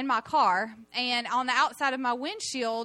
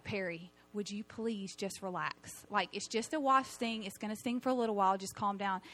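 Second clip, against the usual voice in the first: retold husband's calming; 2 semitones lower, 7 dB quieter than usual. Husband telling wife to relax over a sting, mildly exasperated. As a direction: lightly imitate a husband downplaying the panic. Voices husband soothing her panic.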